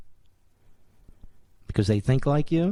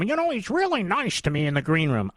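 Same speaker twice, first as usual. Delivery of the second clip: sing-song put-on voice, stress on "really"; a prissy dismissive voice; mocking put-on voice; 4 semitones higher than usual. Host mocks a Todd defender in a put-on voice.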